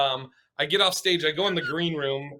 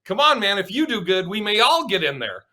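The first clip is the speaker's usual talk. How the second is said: higher, louder, egging a teammate on; cheering buddy voice; 6 semitones higher, 4 dB louder than usual. Retold or imitated. Voicing other guys urging him on, higher and louder. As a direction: hype him up like a locker-room pal.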